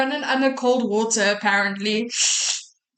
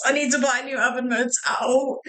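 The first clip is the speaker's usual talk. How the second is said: ordinary talk, nothing marked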